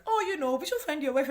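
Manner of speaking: high, loud imitation of nagging relatives; mock family voice. Relatives pressing him about marriage, high mock voice. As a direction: chirp it like pushy parents. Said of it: "where they're like".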